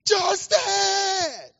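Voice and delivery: loud high shout of his name; father yelling his sleeping son's name at the top of his lungs. Retold or imitated. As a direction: yell the name at full volume to wake a baby. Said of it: "I yelled at the top of my".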